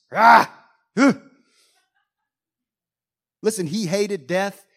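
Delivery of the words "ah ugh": loud, higher snort and groan; angry snort. Shown as a demonstration. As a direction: snort out the anger at the loss. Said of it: "this one's like".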